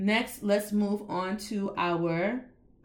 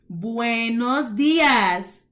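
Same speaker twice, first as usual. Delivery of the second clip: "Buenos dias" very slow and louder; slow model; 2 semitones higher, 6 dB louder, much slower than usual. Shown as a demonstration. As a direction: say "Buenos dias" slowly for repeating. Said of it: "write our first greeting".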